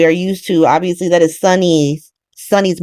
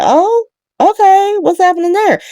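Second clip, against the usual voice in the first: mock innocent warlord; 14 semitones higher than usual. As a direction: play dumb in a high voice. Warlord feigning innocent curiosity.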